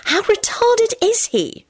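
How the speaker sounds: high, slow incredulous question; high-pitched disbelieving aside; hissed aside. A narrator's reading. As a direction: ask it appalled, drawn out. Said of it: "Rosie hissed to Jamie".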